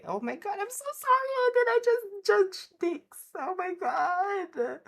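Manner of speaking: squealing high apology; horrified at what she just said; mortified. Real, not acted. Shocked at her own words.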